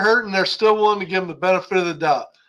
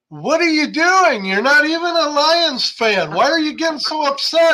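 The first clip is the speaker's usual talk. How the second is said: imitating wife; 7 semitones higher than usual. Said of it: "she's like".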